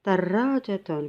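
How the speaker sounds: darajatun, slow syllable by syllable; Arabic word read out. Shown as a demonstration. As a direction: say darajatun clearly and slowly, the Arabic way. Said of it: "On a dit, ça signifie". (darajatun lentement, syllabe par syllabe)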